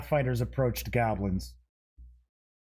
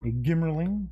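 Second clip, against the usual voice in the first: normal talking voice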